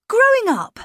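put-on a boy proud of growing up. A narrator's reading. Miles proudly telling his governess, high boy voice. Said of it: "he added".

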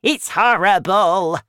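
loud, high, emphatic complaint; shouting. A narrator's reading. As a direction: yell it out in protest. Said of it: "Grandma shouted".

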